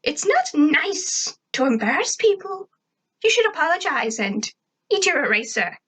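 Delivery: put-on high-pitched scolding girl; Tack. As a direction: tell off a classmate in a high character voice. Tack angrily scolds Sarah.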